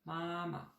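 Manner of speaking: Mama both high and level; first tone mama. Shown as a demonstration. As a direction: keep Mama high and flat on both syllables. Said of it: "on dirait un peu le la de la note musicale". (mama au premier ton, haut et égal)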